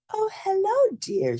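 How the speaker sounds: put-on sweet old lady welcoming; old grandma voice. A narrator's reading. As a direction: grandmother greeting her granddaughter at the door. Grandmother greets girl.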